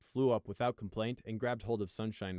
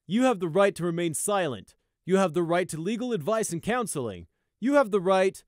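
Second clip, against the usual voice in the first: droning; 9 semitones higher, 10 dB louder than usual. A narrator's reading. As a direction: rattle off rights by rote. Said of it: "he droned on".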